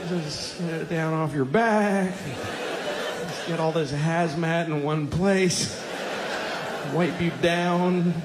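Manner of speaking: lightly voiced, falsely encouraging; mock sweet praise. Dad praising baby's diaper, sugary and patient. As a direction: humor the baby with fake delight. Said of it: "you just go".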